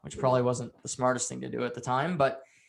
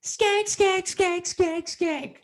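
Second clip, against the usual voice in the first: high-pitched, repetitive mock taunt; 4 dB louder, much slower than usual. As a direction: imitate a childish, squawked taunt. Retold taunt, insult chanted over and over.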